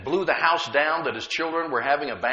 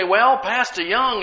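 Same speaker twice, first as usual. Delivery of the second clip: imitating doubters; 8 semitones higher, 4 dB louder, slower than usual. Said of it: "But most people say".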